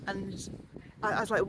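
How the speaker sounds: ordinary delivery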